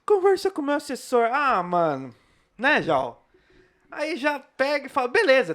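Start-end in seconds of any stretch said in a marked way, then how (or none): 0.0-1.3 snobby celebrity voice. Retold or imitated. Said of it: "esses negocinhos de".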